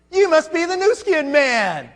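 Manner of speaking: put-on high, slow, sweet old lady voice; older lady voice. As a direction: play the grandma greeting the stranger. Older lady greets him at door.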